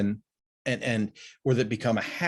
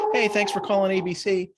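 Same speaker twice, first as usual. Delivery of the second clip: high, bright phone greeting; imitating the friendly store greeting he never gave; cheery receptionist greeting; 8 semitones higher than usual. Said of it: "he doesn't say".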